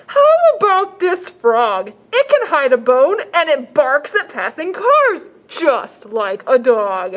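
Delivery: high, slow salesman pitch; shelter man voice. Acted. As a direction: sell it like an eager shopkeeper. Shelter man offering frog.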